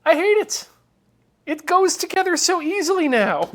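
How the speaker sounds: exasperated outburst, half laughing; mock outrage. Real, not acted. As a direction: yell it in comic frustration. Controller suddenly fits easily.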